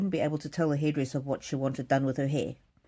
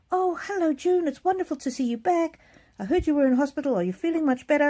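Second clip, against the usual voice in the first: much higher, bright welcoming hairdresser voice; cheery hairdresser; 9 semitones higher, 3 dB louder than usual. Retold or imitated. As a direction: greet her like a sweet salon owner, pitched way up. Voicing the chatty hairdresser.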